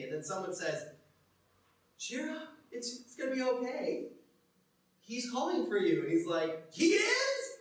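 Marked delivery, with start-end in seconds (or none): 2.0-6.0 high, lilting reassurance; encouraging bystander. Acted. Bystander tells the blind beggar he is being called, encouraging. 6.7-7.6 very high, loud disbelief; astonished. Acted. Blind beggar learns Jesus is calling him, astonished. Blind man hears he is called.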